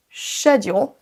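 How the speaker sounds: SCHEDULE opening on a British SH; son CH initial. Shown as a demonstration. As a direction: start SCHEDULE with SH, not SK. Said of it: "On démarre par un son ch". (SCHEDULE avec CH au début)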